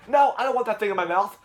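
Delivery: put-on high, loud kid refusal; mock panicky protest; protesting kid character. Voicing Jeffy refusing the drill.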